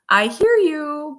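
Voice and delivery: high, loud, drawn-out validation; patient parent script. Retold or imitated. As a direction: stretch it out like a coached parent. Voices parenting tips to whining daughter.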